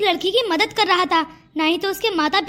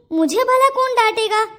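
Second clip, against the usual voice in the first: ordinary talk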